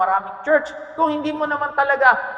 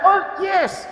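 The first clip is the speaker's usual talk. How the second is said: excited exclaim; 9 semitones higher than usual. Real, not acted. Preacher exclaims mid-sermon.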